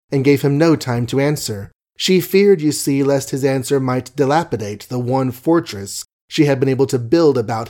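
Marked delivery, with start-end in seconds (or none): none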